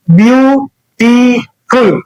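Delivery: Beautiful in three pieces, view, टी, फुल; slow, syllable by syllable. Shown as a demonstration. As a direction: stretch and separate each syllable of beautiful, falling on फुल. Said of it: "इसी प्रकार ना". (Beautiful धीरे से, ब्यू... टी... फुल)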